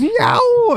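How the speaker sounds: long, high "yow"; drawn-out mimicked yowl of someone falling; imitated falling cry. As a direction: let it stretch as you drop.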